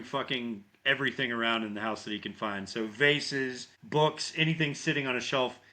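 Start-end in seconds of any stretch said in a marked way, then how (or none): none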